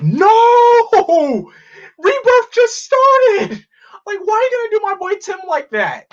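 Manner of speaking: hammed-up fan heartbreak; retold outcry. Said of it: "I was like".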